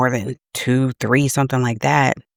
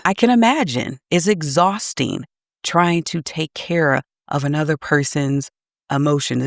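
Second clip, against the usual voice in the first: ordinary talk, nothing marked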